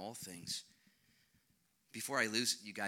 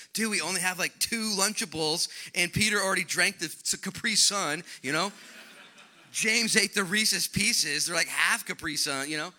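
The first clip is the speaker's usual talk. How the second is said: loud, high, slangy excuse-making; a hint of a whiny teen disciple; 9 semitones higher, 12 dB louder than usual.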